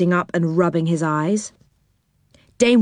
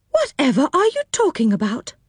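feigned innocence; 8 semitones higher than usual. Pretend. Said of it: "pretending not to know".

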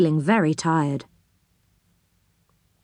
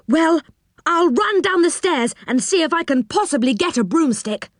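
high, eager voice; keyed-up little man; getting excited; 9 semitones higher than usual. A narrator's reading. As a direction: offer it with rising eagerness. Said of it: "getting all excited".

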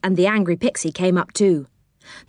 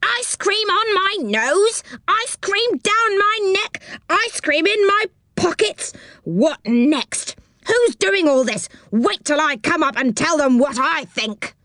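very angry pixie; 11 semitones higher than usual. A narrator's reading. Pixie splattered with ice cream complains up the tree, very angry. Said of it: "a very angry pixie indeed".

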